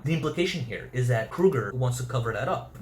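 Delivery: ordinary talk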